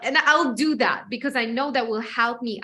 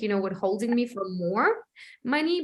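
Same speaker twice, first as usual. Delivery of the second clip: normal talking voice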